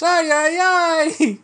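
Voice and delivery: high "Ay, ay, ay"; panicked Italian. Retold or imitated. Scared Italian cop grabbing the lapel, high "Ay, ay, ay". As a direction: gasp it like a terrified cop. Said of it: "She says".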